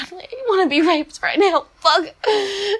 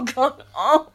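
ordinary talk

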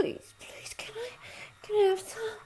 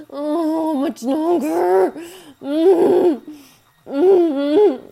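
loud moans; 11 dB louder, much slower than usual. Acted. Loud moaning.

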